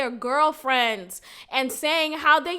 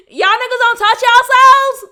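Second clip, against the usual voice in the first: much higher, louder cry of disbelief; disbelieving shout; 9 semitones higher, 11 dB louder than usual. Real, not acted. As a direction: yell it at the whole crowd.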